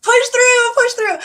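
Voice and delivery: high, loud rallying call; cheering on. Retold or imitated. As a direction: urge a struggling reader to keep going. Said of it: "And I was like".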